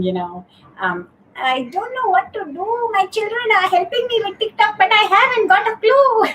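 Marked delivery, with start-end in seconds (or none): 1.4-6.3 high, squeaky, clueless mother voice, pitched much higher; clueless mum voice. Retold or imitated. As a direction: play a flustered mum lost with TikTok, up high. Retold TikTok character voice.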